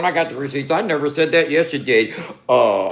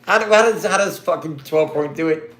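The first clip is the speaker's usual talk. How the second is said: normal talking voice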